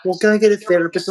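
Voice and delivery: a light parody of people's easy advice; mocking other people. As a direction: toss it off like a know-it-all. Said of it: "people go".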